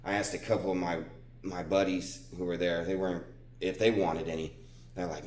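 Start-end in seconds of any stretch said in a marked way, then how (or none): none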